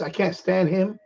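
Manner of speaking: ordinary talk, nothing marked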